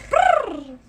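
a long trilled Brrr; rolled r. Shown as a demonstration. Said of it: "roll your R's".

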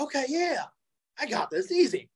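high, breezy I-got-this; cocky confidence. Retold or imitated. Wrestler accepting the booker's plan, cocky and high. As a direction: brush it off like it's easy. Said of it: "I say".

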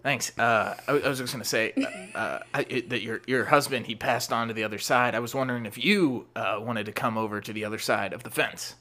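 low, hesitant creepy-neighbour voice; awkward neighbour. Acted. Plays awkward neighbour Daniel.

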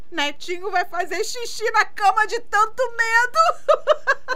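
high, laughing tease; teasing laugh. Real, not acted. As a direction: giggle through the taunt. Aunt teasing nephew, laughing.

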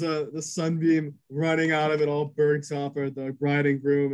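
normal talking voice

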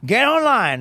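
high-pitched mock coach taunt; Coach Hamilton. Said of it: "he'd be like".

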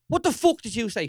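aggressive challenge. Retold or imitated. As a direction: bark it at the passer-by like a threat. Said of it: "he goes".

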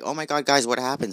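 high, loud alarmed cry. Retold or imitated. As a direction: burst in shocked and worried. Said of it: "my sister was like".

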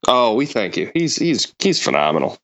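ordinary talk

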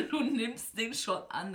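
ordinary talk

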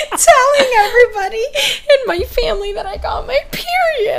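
high, loud, through real laughter; cracking up while telling it; laughing. Real, not acted. Friend telling her embarrassing family story, laughing.

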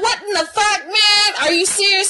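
high, mock-outraged protest; comic high-voiced exasperation; exasperated disbelief. Acted exasperation at sister.

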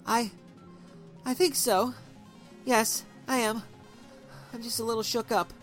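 holding back tears; tearful. A narrator's reading. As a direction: shaken wife asking friend to come. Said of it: "the tears came streaming".

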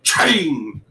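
train with a heavy T, vowel drawn out; over-enunciate train, slow and stretched; hard T. Shown as a demonstration. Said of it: "Saying your T's a little harder".

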